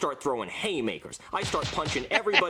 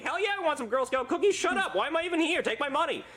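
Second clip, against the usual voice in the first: excited; 12 semitones higher than usual. Retold or imitated. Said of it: "And I'm like".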